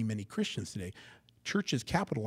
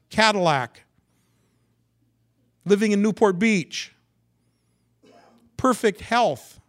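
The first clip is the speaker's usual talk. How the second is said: loud, high, punched-out list of riches; mock prosperity hype; 8 semitones higher, 10 dB louder than usual. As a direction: proclaim each prize in mock triumph. Mocking cartoon prosperity gospel.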